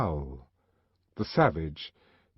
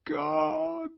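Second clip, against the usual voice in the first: high, breaking cry; the savage crying out in grief; anguished cry. A narrator's reading. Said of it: "savage in grief".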